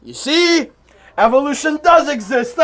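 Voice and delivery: triumphant shout. Acted. Debater yelling in triumph that evolution exists. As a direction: bellow it like you just won.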